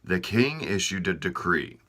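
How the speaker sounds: plain reading voice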